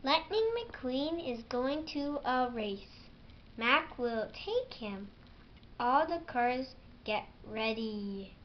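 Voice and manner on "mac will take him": ordinary delivery, nothing marked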